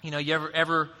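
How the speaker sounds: normal talking voice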